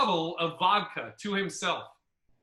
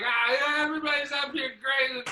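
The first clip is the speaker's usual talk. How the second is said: put-on drunk headliner; 6 semitones higher than usual. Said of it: "he's like".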